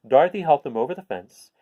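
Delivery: ordinary delivery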